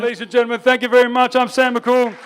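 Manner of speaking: loud, high stage sign-off; big closing call to the audience; rousing sign-off. Real, not acted. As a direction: project your thanks to the whole room. Comedian signing off to the crowd.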